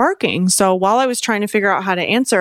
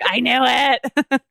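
mock vindication; 4 semitones higher, much slower than usual. Sister's imagined reaction.

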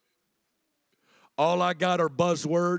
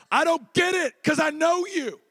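higher, crying out to God; pleading cry; 10 semitones higher than usual. Acted. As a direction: shout the plea in raw faith. Voicing raw honest prayer.

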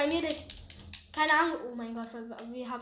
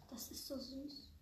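whispering; 15 dB quieter than usual. Real, not acted. Whispers touched by fan.

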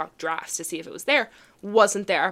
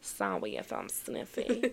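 mock stuffy voice; 10 semitones lower, 11 dB quieter, slower than usual. Teasing friend's stuffy nose.

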